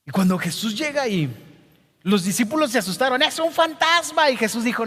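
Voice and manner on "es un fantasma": frightened cry. Acted. Disciples seeing a figure on the water, frightened. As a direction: pitch it high in sudden fright.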